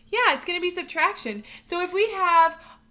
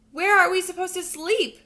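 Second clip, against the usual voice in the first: higher, louder puzzled frog voice; frogs asking; 5 semitones higher, 7 dB louder than usual. Acted. Teacher voicing the frogs in a picture book, higher and louder. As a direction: ask like worried little frogs. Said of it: "asked the frogs".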